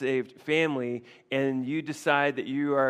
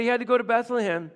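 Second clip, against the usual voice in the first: higher, louder, fast pleading; pleading excuse; 9 semitones higher, 6 dB louder, faster than usual. Retold or imitated. Jonathan defending David to Saul, a higher hurried plea. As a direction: rush the excuse out.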